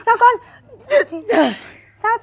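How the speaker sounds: high, rapid-fire demands; urgent pressing. Acted. A mother frantically pressing her son to speak, high. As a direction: fire the questions at him.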